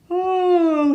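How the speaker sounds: high, loud stretching yawn. Acted. Sleepy Amos yawning under the covers, a big high yawn. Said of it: "he yawned".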